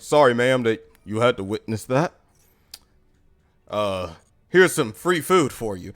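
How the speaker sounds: low, smooth-talking apology; hesitant, deep mock courtesy; apologetic manager. Manager smooth-talking teller's mom.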